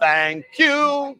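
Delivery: sweet, eager little thank you; cute high thank you. Shown as a demonstration. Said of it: "And you gotta go".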